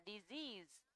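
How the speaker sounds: "disease" stressed as di-SEASE; stress on second syllable. Shown as a demonstration. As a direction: lean on the last syllable of "disease". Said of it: "trọng âm sẽ rơi vào disease".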